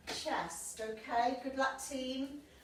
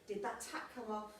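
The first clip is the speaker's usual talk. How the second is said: usual speaking voice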